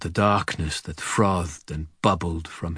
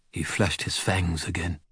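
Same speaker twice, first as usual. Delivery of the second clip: ordinary delivery, nothing marked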